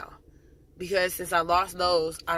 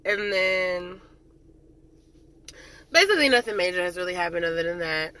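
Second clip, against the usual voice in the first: ordinary talk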